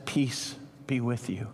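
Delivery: tender peace spoken to the disciples; soft blessing. Acted. Jesus greeting Thomas with peace.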